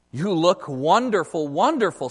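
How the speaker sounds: gushing, loud praise; flattering ape. Acted. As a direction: lay the flattery on thick. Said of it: "said the ape".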